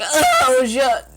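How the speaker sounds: loud, high groan of frustration; exasperated. Retold or imitated. Learner catching herself mixing up French words, exasperated. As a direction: burst out, annoyed at your own slip. Acts out frustration at own mistake.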